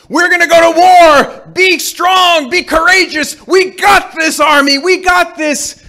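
high, loud pep talk; rousing general. Pretend. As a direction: fire up the army. Imagined general rallying the army.

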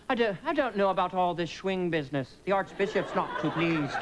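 put-on disapproving Irish accent; tut-tut in an Irish brogue. Strict Irishman objects to the film, Irish accent. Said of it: "we went to Ireland to promote Wayne's World. Very strict country".

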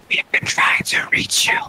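breathy unvoiced whisper. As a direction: whisper it into their ear. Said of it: "whisper in their ear".

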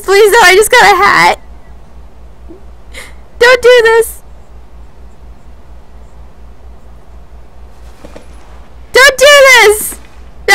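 high, loud frantic pleading; panicked begging. Real, not acted. Player pleading with the monster not to hurt him. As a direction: beg for your life in terror. Begging enemy in horror game.

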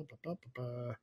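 ordinary delivery

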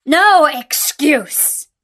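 beast's answer. A narrator's reading. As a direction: cut off all argument with a drawn-out roar. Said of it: "answered the beast".

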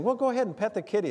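hammed-up casual reassurance; coaxing quote. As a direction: breezily urge someone to pet a lion. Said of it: "somebody saying".

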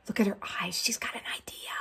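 soft conspiratorial whisper. Real, not acted. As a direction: let them in on a secret. Hushed aside to listener.

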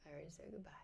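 very soft whisper; whispered aside. Real, not acted. Streamer lingering after her sign-off, whispered aside. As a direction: whisper it to yourself.

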